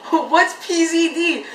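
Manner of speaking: high, slow puzzled imitation; Dongwon.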